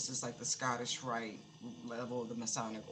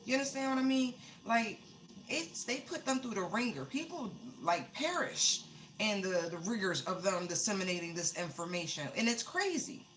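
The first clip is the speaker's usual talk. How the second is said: excited; 6 semitones higher than usual. Real, not acted. Worked up over the organizations.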